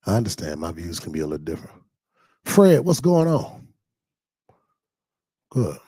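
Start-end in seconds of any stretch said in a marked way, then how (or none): 2.4-3.7 higher, louder, slow greeting call. Real, not acted. Greeting a viewer named Fred, called out loud. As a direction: hail a friend across the room.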